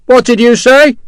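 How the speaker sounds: mocking call. Sharp mocking, hand to ear.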